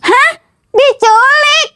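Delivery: shocked. Acted. Girl hears from Jamal that Ratna was kidnapped, shocked. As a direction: react in alarm at the news.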